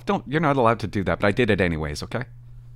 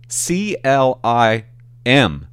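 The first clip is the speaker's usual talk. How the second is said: C L I M spelled out letter by letter, very slowly; slow spelling; 5 dB louder, much slower than usual. Shown as a demonstration. Comedian spelling his handle for fans, very slowly. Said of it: "Let me spell it".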